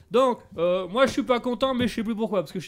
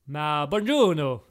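lightly voiced Italian examiner, slow and low; Italian accent; 6 semitones lower, slower than usual. Examiner in fake Italian answers the reporter, lightly Italian. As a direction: greet in a put-on Italian accent. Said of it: "tu fais vachement bien l'accent italien".

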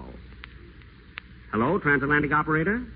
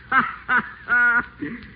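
high wicked chuckle; a high, gloating cackle; gleeful laugh; 11 semitones higher than usual. Acted. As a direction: laugh at your own scheming. Whiteside gloating after scheming.

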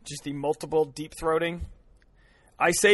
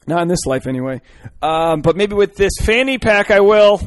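much louder, rising shout; 4 semitones higher, 10 dB louder than usual. Real, not acted. Yelling about fanny pack.

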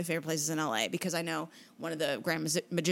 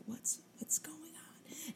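slow breathless whisper; 8 semitones higher, 13 dB quieter, much slower than usual. Retold or imitated. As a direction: hush it out confused. Confused reaction retold.

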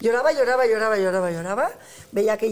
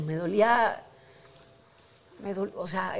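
ordinary talk, nothing marked

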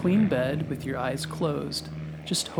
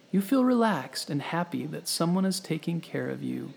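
usual speaking voice

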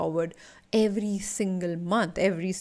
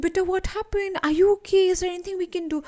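high, doting auntie voice, much higher pitch; fussing aunties; 10 semitones higher, 4 dB louder, faster than usual. Retold or imitated. Coach mimics wedding aunties asking about the bruise, high fussy concern. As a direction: play the nosy, fretting aunties at a wedding. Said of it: "those aunties to walk up to you and ask you".

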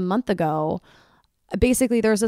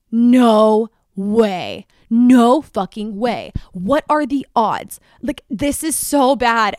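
loud, higher and slow, spelling out the shock; shocked outcry; 4 semitones higher, 9 dB louder, much slower than usual. Retold or imitated. Realizing the girl's guy is the one she texts, loud horrified disbelief. Realizing it's the same boy.

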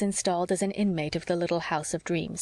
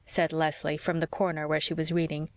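usual speaking voice

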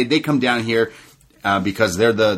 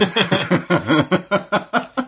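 high giggle; 9 semitones higher than usual. Real, not acted. Giggling at MJF theme joke.

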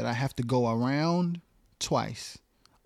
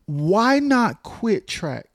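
stretched out, high and louder; slow emphatic question; 7 semitones higher, 5 dB louder, much slower than usual. Real, not acted. Host to guest, slow, high emphatic challenge. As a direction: draw out each word to challenge her.

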